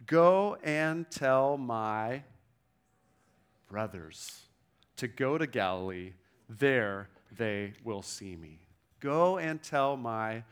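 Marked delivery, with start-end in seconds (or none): 0.0-8.6 linger, then drop gently onto brothers; savor the word brothers. Shown as a demonstration. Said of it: "a single word I want you to see".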